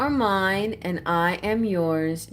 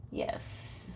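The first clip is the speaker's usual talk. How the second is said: usual speaking voice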